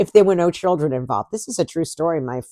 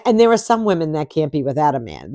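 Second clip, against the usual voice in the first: normal talking voice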